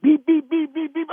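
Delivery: very high-pitched rapid beeping; smoke detector beeps. Retold or imitated. Man imitates his pounding heartbeat while shoveling, as high smoke-detector beeps. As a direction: beep like an alarm in a squeaky high voice. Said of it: "sound like a goddamn smoke detector".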